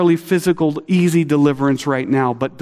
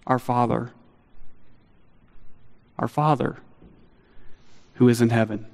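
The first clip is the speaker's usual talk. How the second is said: simple intimate prayer; 2 semitones lower, 7 dB quieter than usual. Retold or imitated. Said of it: "you want to know how to pray".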